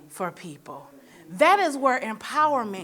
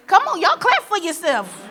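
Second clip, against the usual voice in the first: rallying call; 8 semitones higher, 6 dB louder than usual. Real, not acted. Urging the audience to applaud.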